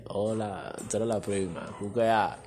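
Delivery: deep voice retold. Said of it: "no, él habló normal".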